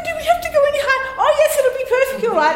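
high, fast, laughing mock worry; mock nervous. Father teasing the girls in the front, high laughing mock worry. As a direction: ham up the fright for the girls. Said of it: "playing to the audience, going".

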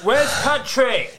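high, drawn-out crowd heckle; mock audience shout, pitched high; heckler calling out. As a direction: call out from the back of an arena. Plays heckler in arena crowd.

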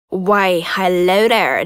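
low, slow sultry greeting; slow seductive hello; sexy voice. Acted. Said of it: "not the sexiest voice".

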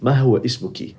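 standard Arabic question, formal. Shown as a demonstration. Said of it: "I'm gonna speak the formal now".

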